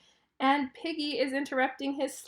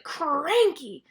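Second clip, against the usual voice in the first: "cranky" louder, drawn out with heavy emotion; emphatic; 3 semitones higher, 5 dB louder than usual. Shown as a demonstration. Showing children how italicized capitals sound, "cranky" drawn out emphatically. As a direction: stretch "cranky" slowly and lean hard into it. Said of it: "say it with a lot of emotions".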